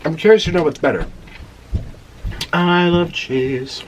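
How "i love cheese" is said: slow savouring. Acted. Savouring cheese again.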